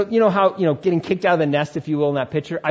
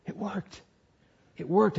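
hushed triumph; 5 semitones higher, 6 dB quieter than usual. Retold or imitated. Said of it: "I'm just like".

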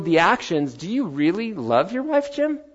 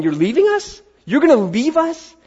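higher and louder, shocked disbelief; alarmed disbelief; 11 semitones higher, 5 dB louder than usual. Retold or imitated. Disciples hear Jesus is leaving, alarmed and disbelieving. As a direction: cry out like disciples being abandoned.

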